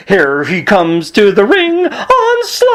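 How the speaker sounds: a hammed-up wrestling entrance call; mock ring announcer jingle. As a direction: call it out like a cheesy ring intro. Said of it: "he came up with this jingle".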